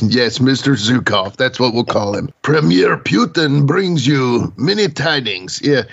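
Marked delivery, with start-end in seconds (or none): 2.4-5.6 put-on slow Russian accent; grand mock Russian announcement. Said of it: "no, but seriously".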